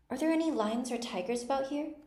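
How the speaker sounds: high, timid question. A narrator's reading. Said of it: "she asked timidly".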